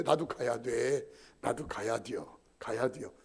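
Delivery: put-on low, slow drunken mumble; drunken insistence. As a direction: slur that you must go home too. Drunk elder insists too. (취한 형님의 낮고 느린 고집)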